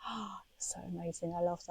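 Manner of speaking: hushed, awestruck murmur, very soft; open-mouthed awe. Retold or imitated. Re-enacting hearing friends speak many languages, hushed awe. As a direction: say it under your breath, as if struck by wonder. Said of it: "I literally stare open mouthed like".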